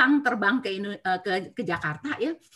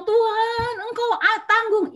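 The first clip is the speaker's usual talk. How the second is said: high, tearful, reliving the moment; retold weeping prayer; 9 semitones higher, slower than usual. Widow telling how she cried to Jesus, high and tearful. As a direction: pray through remembered tears. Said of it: "Saya nangis sampai saya bilang".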